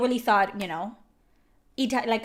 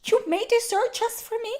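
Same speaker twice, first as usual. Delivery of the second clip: pitched way up, hammed-up weepy gratitude; mock teary gushing; 10 semitones higher than usual. Streamer mocking the character's gratitude for dessert, hammed-up teary voice. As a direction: overplay a tearful thank-you for dessert. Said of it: "I didn't wanna cry".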